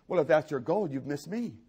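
ordinary talk, nothing marked

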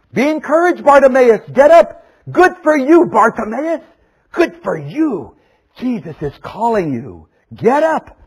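high, loud cheering on; rousing encouragement. Acted. Crowd telling Bartimaeus Jesus calls him, rousing cheer. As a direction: urge him to his feet, loud and high. Crowd urging blind man to rise.

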